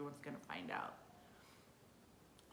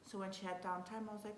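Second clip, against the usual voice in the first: usual speaking voice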